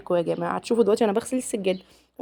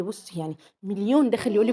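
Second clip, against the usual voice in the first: normal talking voice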